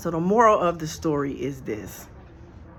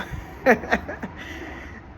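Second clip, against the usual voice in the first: breathy laugh; 7 semitones higher than usual. Real, not acted. Laughing at distracting loud brothers.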